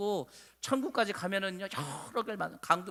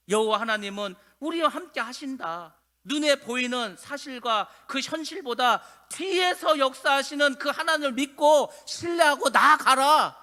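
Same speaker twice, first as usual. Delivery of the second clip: slow, building proclamation to a loud high finish; preaching at full force; 7 semitones higher, 8 dB louder, slower than usual. Real, not acted. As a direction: stretch the words and swell into a rallying command.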